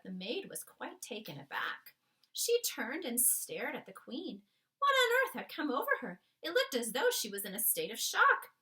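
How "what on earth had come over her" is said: plain reading voice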